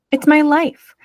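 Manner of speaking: high, loud, sharp retort. A narrator's reading. Pearl defending her stuff to Mom, sharp and loud. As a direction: snap back in defence. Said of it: "said Pearl sharply".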